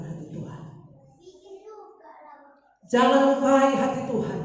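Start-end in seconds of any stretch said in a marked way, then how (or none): 2.9-4.4 loud, high preaching cry; raised, urgent sermon shout; forceful exhortation. Real, not acted. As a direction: drive the warning home at full voice. Repeats it forcefully to congregation.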